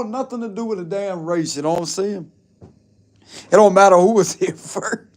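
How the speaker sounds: ordinary talk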